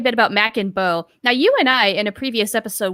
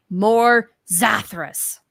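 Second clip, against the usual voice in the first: drawn-out, very slow "More Zathras"; slow quoted plea; much slower than usual. Retold or imitated. Host quotes Twitter fans, slow and drawn out. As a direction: stretch each word like a fan's chant. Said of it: "people say".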